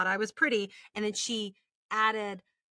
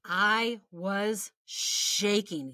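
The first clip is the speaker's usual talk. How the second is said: slow stunned; much slower than usual. Real, not acted. Shaken recounting.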